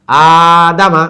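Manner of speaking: আদামা with loud, long আ and quick দামা; stretch on first vowel. Shown as a demonstration. Teacher modelling the word for students, long আ in আদামা. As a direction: hold আ, then hurry through দামা. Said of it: "আতে টান আছে".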